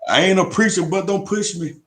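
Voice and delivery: mock warning, pitched high; playful protest. Real, not acted. Answering the co-host's call to preach, playful protest. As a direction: playfully push back, voice jumping up high. Playful reply to being called pastor.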